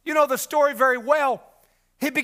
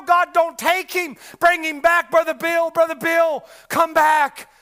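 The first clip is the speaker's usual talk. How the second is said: higher, louder pleading to God; retold pleading cry; 7 semitones higher, 7 dB louder, slower than usual. Brother Roy begging God to bring him back, loud plea. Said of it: "woke up crying".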